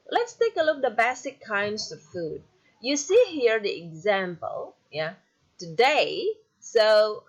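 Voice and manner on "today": "today" with quick TO and strong DAY; second-syllable stress. Shown as a demonstration. Teacher showing an iamb example, second-syllable stress on "today". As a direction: put the weight on "day" in "today". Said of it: "you stress on the word day".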